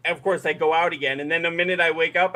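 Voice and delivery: usual speaking voice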